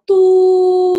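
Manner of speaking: a high flat "doo" like a phone that won't connect; mock dial-tone hum; phone busy tone. Shown as a demonstration. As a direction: imitate the line-busy beep, high and held. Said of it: "有点像电话接不通".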